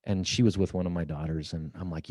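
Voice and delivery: ordinary delivery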